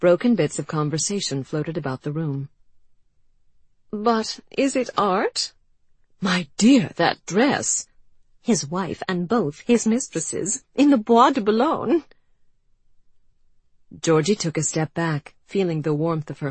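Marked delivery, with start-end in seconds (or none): 3.9-5.5 slow, higher, haughty art-snob voice; snobbish drawl. Acted. Overheard snobbish exhibition chatter. 6.2-7.9 louder, higher scandalised gossip; gossipy gasp. Acted. Gossiping exhibition guest. 8.4-12.0 slow then rising, louder scandal; juicy gossip. Acted. Gossiping exhibition guest.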